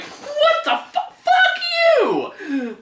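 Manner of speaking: high shrieking outrage; loud then suddenly hushed. Retold or imitated. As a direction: shriek it at the screen. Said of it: "Uh, sorry, too loud".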